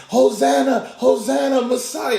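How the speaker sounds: high, loud acclaim; jubilant crowd cheer. Acted. Crowd welcoming the Messiah's royal entry, high jubilant cheering. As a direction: hail him like a cheering crowd.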